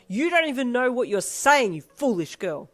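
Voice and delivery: berating a foolish daughter; booming. Acted. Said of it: "he boomed".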